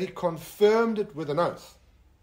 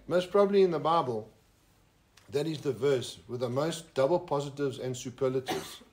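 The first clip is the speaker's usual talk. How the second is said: normal talking voice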